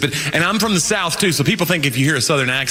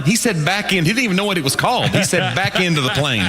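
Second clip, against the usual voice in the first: normal talking voice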